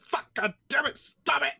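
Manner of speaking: high-pitched mock exasperation; retold frustration. Retells frustration watching the game.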